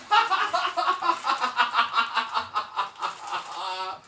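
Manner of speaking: long high laughter; high giggle fit. Real, not acted. Laughs after silly song.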